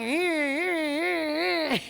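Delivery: high, drawn-out straining starter noise; imitated engine cranking. Woman trying to start the neighbor's old truck, imitated cranking whine.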